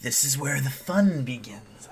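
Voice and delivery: slow, drawn-out villain line; Joker. Acted. Vlogger attempting a comic-book villain, slow impression. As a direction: stretch it out like a bad villain impression. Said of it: "I can't do a Joker impression".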